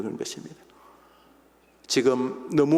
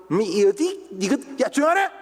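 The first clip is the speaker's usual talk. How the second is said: rising higher scornful taunt; mocking taunt; 10 semitones higher than usual. Crowd mocks the blind man, rising sneering taunt. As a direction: sneer and climb to a jeer.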